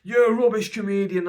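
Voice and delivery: high, loud jeering troll voice; mocking troll. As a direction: sneer it like an online hater. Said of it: "ever anybody says to me".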